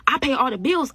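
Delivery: mock defiant boast. [name]'s boast.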